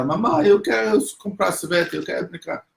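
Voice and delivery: put-on pleading child. Child begs to leave.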